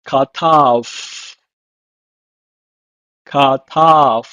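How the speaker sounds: katav said "kah-tahv" twice; "Katav" said twice, falling; Hebrew word. Shown as a demonstration. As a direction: model the Hebrew "katav" then repeat. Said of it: "It's pronounced in Hebrew as".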